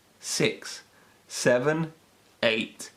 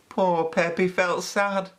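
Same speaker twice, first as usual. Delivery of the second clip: ordinary delivery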